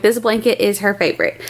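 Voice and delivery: ordinary talk, nothing marked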